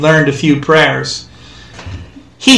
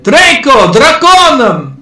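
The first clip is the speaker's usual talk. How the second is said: high, loud, stretched cry; shouted; 9 semitones higher, 4 dB louder, slower than usual. A narrator's reading. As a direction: keep yelling at the crowd. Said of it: "the American shouted".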